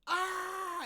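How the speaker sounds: loud, high transformation yell; imitated power-up scream. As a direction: scream like an anime fighter powering up.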